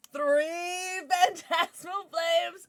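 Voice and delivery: very slow, high, loud proclamation; mock-dramatic announcer. Real, not acted. Streamer counts a third identical pack, mock-dramatic announcer. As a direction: proclaim the count, stretching it right out.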